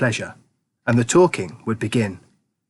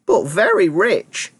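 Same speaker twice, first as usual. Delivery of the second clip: put-on amused old grandpa; 8 semitones higher, slower than usual. A narrator's reading. Grandpa George scoffing to Charlie, high old voice. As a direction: dismiss it with an old man's chuckle.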